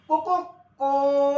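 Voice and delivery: high, loud, slow "को, को, को", the last one held longest; "को, को, को" sung out high and long, growing each time; drawn-out rooster call. Shown as a demonstration. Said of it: "असा आवाज काढतो".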